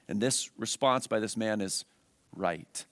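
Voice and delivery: ordinary delivery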